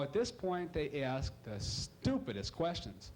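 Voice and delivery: ordinary talk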